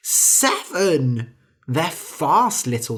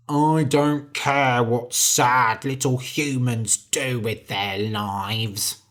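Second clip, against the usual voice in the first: slow, low grumbling giant; 3 semitones lower, slower than usual. A narrator's reading. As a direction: sneer it like a bored giant. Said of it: "said the giant".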